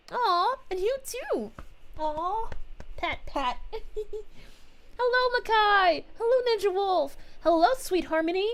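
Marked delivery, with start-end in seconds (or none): none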